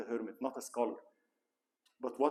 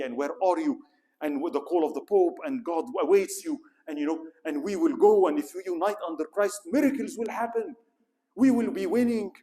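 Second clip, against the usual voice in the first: higher, louder, building to a rally cry; fiery preaching; 5 semitones higher, 8 dB louder than usual. Retold or imitated. Peter the Hermit rousing the people to the crusade, in a loud fiery voice. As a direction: whip the crowd into a frenzy. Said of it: "And he started preaching".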